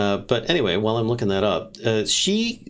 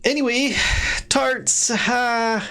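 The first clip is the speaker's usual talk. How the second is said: excited; much slower than usual. Acted. GM voicing excited NPC.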